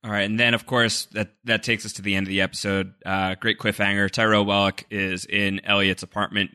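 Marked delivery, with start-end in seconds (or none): none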